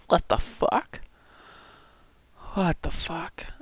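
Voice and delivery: hushed disbelieving whisper. Real, not acted. Stunned by the right-wing take, whispering.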